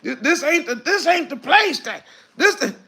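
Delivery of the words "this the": normal talking voice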